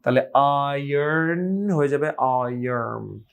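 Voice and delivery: slow, the final আয়ার্ন ending in an m sound; n turning to m. Shown as a demonstration. Teacher shows n turning into m. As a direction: stretch out আয়ার্ন, closing the n into m. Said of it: "নটা change হবে মতে".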